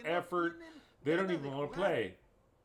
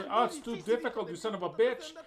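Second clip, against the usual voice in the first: sarcastic mock; 6 semitones higher, 3 dB louder than usual. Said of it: "mocking Russo's excuse".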